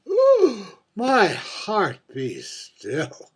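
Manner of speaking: high swooning ooh, then a slow breathy sigh of delight; mock swoon. The drag queen swoons at the memory of her plunging neckline, high and delighted. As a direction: swoon over your own glamour.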